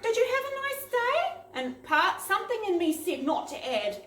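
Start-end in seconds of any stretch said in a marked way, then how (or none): 0.0-1.4 put-on high, small polite little-girl voice; timid child. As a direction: ask it like a nervous young girl. Nervous child on the phone.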